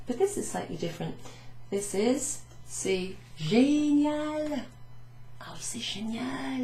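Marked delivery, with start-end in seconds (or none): 2.7-4.6 very slow, high and amazed; mock awe. Shown as a demonstration. Begeisterung übertrieben vorgespielt. 5.4-6.6 breathless amazed whisper; whispered awe. Shown as a demonstration. Flüsternde Wiederholung.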